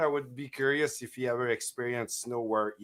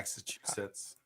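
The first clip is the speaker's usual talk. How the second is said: whispered echo; 4 semitones lower, 15 dB quieter than usual. Pretend.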